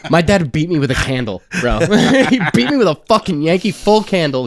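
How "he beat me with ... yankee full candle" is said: laughing, higher and louder; laughing while recalling a beating. Real, not acted. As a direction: laugh through the painful memory.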